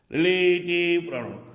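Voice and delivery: Relative pronoun held long on a level tone; emphatic, lengthened relative pronoun. Shown as a demonstration. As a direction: stretch the vowels of relative pronoun for the class. Teacher repeats term drawn out.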